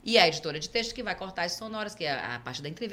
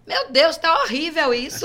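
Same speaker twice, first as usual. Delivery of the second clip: loud, high, slow and laughing; mother mock-horrified at the first report; mother laughing in horror; 9 semitones higher, 11 dB louder than usual.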